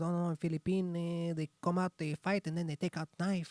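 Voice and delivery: lightly voiced Filipino accent, earnest warning. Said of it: "He says".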